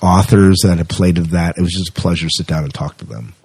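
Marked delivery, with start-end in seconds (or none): none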